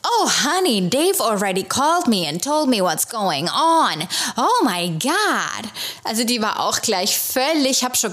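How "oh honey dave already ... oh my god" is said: sympathetic American gushing, honey and all; gushing receptionist. Retold or imitated. Receptionist greeting the caller with sympathy: gushing American coo. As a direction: coo it like a caring front-desk lady. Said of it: "die hat dann gleich gesagt".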